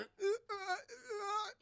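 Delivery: high, soft, whimpering uh sounds; mock pained whimper while changing; whimpering groans. Liu Kang mid-transformation, high whimpering groans.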